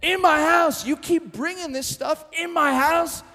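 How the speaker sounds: high, loud, slow indignant outcry echoing the Godfather; an offended owner shouting at what's brought in; God imitating the Don's furious rant; outraged God. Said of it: "God ever looks at your life and goes".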